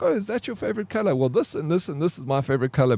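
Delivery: put-on high, fast little girl with an American accent; imitating girl's American accent. Imitating the Afrikaans daughter's sudden American accent. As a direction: chirp it like a seven-year-old with an American accent. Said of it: "But she has an American accent".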